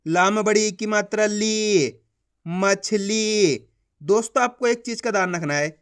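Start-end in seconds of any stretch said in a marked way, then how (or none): 1.3-1.9 ली with the ee drawn out; teacher's drawn-out ली; stretched long ee. Shown as a demonstration. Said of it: "खींच के पढ़ा जाता है". 2.5-3.7 मछली very slowly, final ली held; slow teacher's sounding-out of मछली; stretched long ee. Shown as a demonstration. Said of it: "खींच के पढ़ा जाता है".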